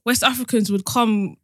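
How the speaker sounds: ordinary talk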